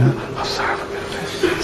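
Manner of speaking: breathy whisper, giving in; whispered submission. Retold or imitated. Woman repeating submissively.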